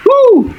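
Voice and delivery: very high-pitched "woo" whoop; high cheer. Pretend. Child celebrates getting out of finishing food, gleeful whoop. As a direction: let out a short, high whoop.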